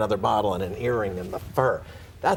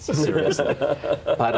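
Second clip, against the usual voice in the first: higher, laughing aside; amused admission; 6 semitones higher, 3 dB louder, much slower than usual. Real, not acted. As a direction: chuckle it out.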